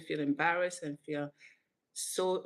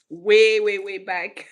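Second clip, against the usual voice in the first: "Way" punched loud and raised; emphatic repetition; 3 semitones higher, 12 dB louder, slower than usual. Real, not acted. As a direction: hammer each "way" to stretch the distance back. Exaggerating how long ago.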